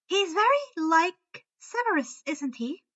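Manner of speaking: high, musing; sage. A narrator's reading. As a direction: muse knowingly. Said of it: "Luna sagely nodded".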